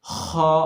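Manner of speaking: खा scraped out from the upper throat; scraped kha. Shown as a demonstration. Teacher demonstrating the letter, scraped from throat. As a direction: clear the throat into खा. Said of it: "मुंह को खोलने के बाद".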